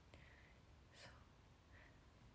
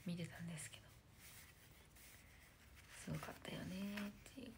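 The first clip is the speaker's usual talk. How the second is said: unvoiced hushed aside; whisper; 3 semitones lower, 15 dB quieter, faster than usual. Real, not acted. Whispering a confession to her viewers. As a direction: drop to a secret whisper.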